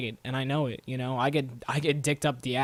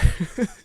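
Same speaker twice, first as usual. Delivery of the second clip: short laugh; 6 semitones higher, 6 dB louder than usual. Real, not acted. Laughs at own joke.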